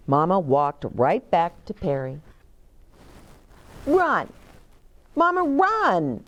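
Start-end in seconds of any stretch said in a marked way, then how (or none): 3.8-6.2 put-on high eager toddler shout; high excited child call; child cheering. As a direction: urge mama on like a little kid. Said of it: "said Perry".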